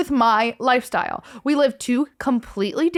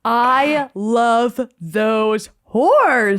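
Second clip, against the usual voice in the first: very slow emphatic drawl; 5 dB louder, much slower than usual. Real, not acted. Drawn-out emphatic affection.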